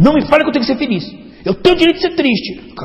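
hammed-up sulky protest; mock defiant retort. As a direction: imitate a huffy listener insisting on sadness. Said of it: "Agora a pessoa diz".

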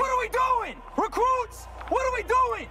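drill sergeant shout. Acted. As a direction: bark it at the recruits. Drill sergeant yelling at recruits.

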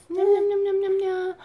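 high quick nom noises; playful nom-nom. Pretend. Mother making high eating sounds for baby. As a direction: chomp it up for the baby.

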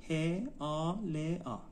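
ه آ ل آ slowly, one sound at a time; slow sound-by-sound teaching of ه آ ل آ; spelling out. Shown as a demonstration. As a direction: spell ه آ ل آ with gaps between the sounds.

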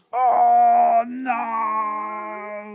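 high fed-up wail; exasperated dad. Acted. Sleepless dad at his wits' end, high wailing cry. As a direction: wail it like a worn-out father. Dad's refrain retold to child.

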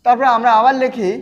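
normal talking voice

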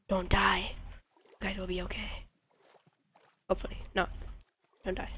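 soft whisper to the squids; whispered plea. Real, not acted. Talking to dying squids, soft whisper. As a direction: whisper them comfort.

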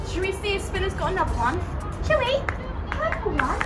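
high, quick coaxing pet voice; talking to a pet. Real, not acted. Coaxing her horse about feed.

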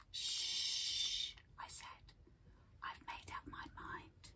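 soft whisper, reassuring; whispered hush. Acted. Child hushes the rat.

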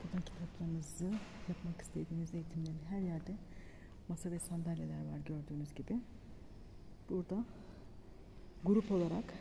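hushed library whisper. Real, not acted. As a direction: keep it quiet in the library. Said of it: "sessiz olacağız".

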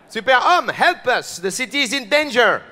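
high, urgent cry for help; citizen calling for help. Acted. Citizens begging the French superhero to save the city, alarmed cry.

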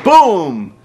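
loud, drawn-out exclamation, falling in pitch; booming impact cry. Retold or imitated. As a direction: shout the hit like a blast.

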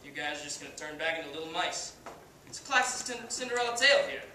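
cocky washed-up pitcher, swaggering speech; brash halftime pep speech, full of bravado; Kenny Powers. Acted. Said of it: "as Kenny Powers".

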